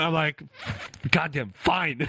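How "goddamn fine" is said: high-pitched mock exasperation; comic, grudging surrender; upset giving-in. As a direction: give in with retold annoyance. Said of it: "like, upset".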